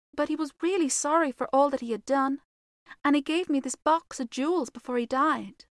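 put-on grave, measured girl's voice; quiet young girl. A narrator's reading. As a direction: explain it simply and earnestly, as a girl would. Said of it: "said Virginia quietly".

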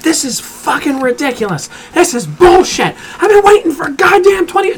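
high-pitched furious rant at the staff; angry yelling. Retold or imitated. Angry customer screaming at teenage staff, high yell. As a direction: shriek it like an impatient customer losing it. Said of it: "just screaming at him".

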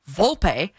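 "Volpe" as "VOL-pay"; Italian pronunciation. Shown as a demonstration. Italian teacher defending how she says the name, clear Italian pronunciation. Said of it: "the way I say".

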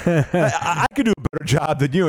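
laughing through a fake heckle, a bit fast; barely holding in a laugh. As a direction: giggle as you throw the challenge. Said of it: "then I was like".

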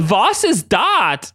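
slow, exaggerated German-accented question; Kurt. Acting Kurt's German-accented question.

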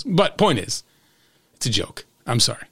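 usual speaking voice

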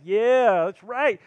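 loud, proud "yeah, that's right"; husband cheering his wife's win, loud; triumphant cheer. Retold or imitated.

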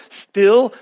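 Texas drawl on "steal", vowel short like "still"; "steal" with a Texan short i, like "stihl"; Texas accent. Real, not acted. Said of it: "My Texas accent won't let me say that".

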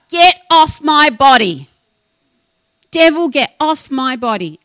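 emphatic retold yell, falling at the end; retold scream. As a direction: act out her screaming at the devil, stopping short of a real scream. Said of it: "she actually screamed out".

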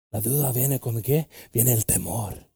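normal talking voice

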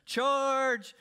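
shouted charge, high and loud; rally the troops like a charging army; mock battle charge. Acting army charge again.

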